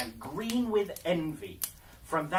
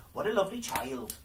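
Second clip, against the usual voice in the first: delighted exclaim; 6 semitones higher, 3 dB louder, faster than usual. Acted. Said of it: "cried they".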